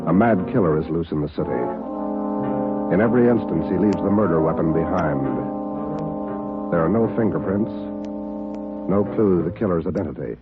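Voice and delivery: normal talking voice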